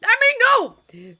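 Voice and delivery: high, shouted panicky splutter; frantic squealed backpedalling. A narrator's reading. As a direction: blurt each word out very loud, flustered. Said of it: "Fish legs spluttered".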